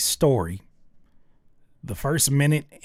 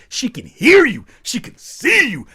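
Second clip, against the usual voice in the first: high, loud outburst on each phrase; exasperated shouting; 11 semitones higher, 10 dB louder than usual. Real, not acted. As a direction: hammer it home at full volume. Exasperated rant at whispering singer.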